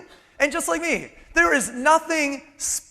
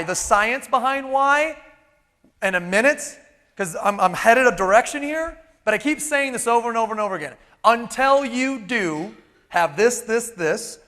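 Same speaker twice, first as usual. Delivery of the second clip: normal talking voice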